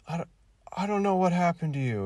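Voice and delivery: high, loud mock-pathetic line; pathetic delivery. Mocking a bad actor's delivery, high and pathetic. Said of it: "pathetic way".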